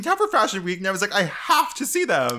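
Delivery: high, loud gushing influencer voice; James Charles impression. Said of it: "my James Charles impression".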